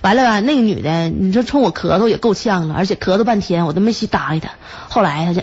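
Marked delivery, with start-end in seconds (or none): none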